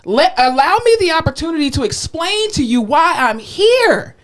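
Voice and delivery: high, emphatic, stretched-out plea; mock exasperated explanation. Therapist reasoning with stubborn patient, mock exasperation. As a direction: plead with overdone patience.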